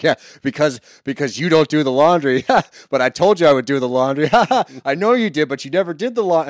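hammed-up escalating squabble over laundry; a couple's fake-cheery jabs building into a shouted row; mock bickering couple. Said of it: "then it just slowly ramps up".

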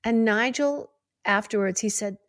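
ordinary talk

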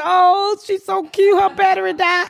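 lightly high mocking coo. Neighbors fussing over the cute girl, mock sweet coo. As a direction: mimic the neighbors' sweet cooing, a bit high. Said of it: "They say".

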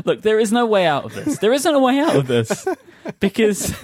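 high, laughing through the words; giggling while insisting. Real, not acted. Laughing while holding firm.

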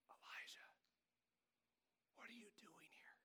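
very soft whisper; hushed whisper of the Lord; still small voice. Acted. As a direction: breathe it like God's gentle voice. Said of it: "there was a still small voice".

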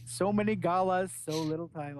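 Captain Boomerang Australian voice; Australian accent, lightly voiced. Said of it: "Boomerang said gala".

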